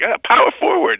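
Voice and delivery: high, loud, excitable radio host; Mad Dog voice. Retold or imitated. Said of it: "And he's like".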